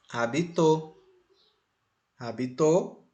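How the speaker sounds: "habitou" stressed at the end, a-bi-TÓ; stress on final syllable. Shown as a demonstration. Teacher shows a third possibility, stress on the last syllable. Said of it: "o acento lá no final".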